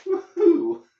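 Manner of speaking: squealed woo-hoo from heat; high whoop. Real, not acted. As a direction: yelp it out high. Still feeling the heat.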